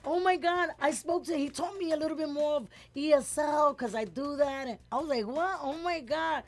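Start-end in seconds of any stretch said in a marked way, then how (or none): 0.0-4.7 high, loud shocked cry; shocked outcry. Retold or imitated. Assistant shocked, crying out. 5.3-6.4 high amazed gasp of words. Retold or imitated. Her own amazement retold.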